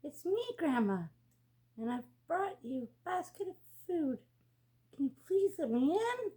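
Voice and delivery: high, sugary put-on sweetness; wolf faking a sweet voice to fool Grandma; sweetest voice. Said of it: "in the sweetest voice he could muster".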